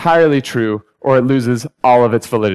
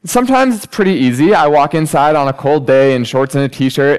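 ordinary delivery

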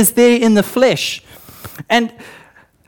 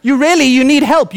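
mock shouting; 6 semitones higher, 3 dB louder than usual. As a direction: imitate a mirror yelling at you. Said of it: "it's shouting".

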